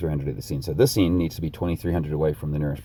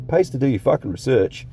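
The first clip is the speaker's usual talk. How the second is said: loud, higher, sarcastic aside; sarcastic jab; 8 semitones higher, 6 dB louder than usual. Real, not acted. To viewers about his own homework, a loud sarcastic jab. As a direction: rub it in with a loud sneer.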